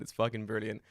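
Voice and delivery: usual speaking voice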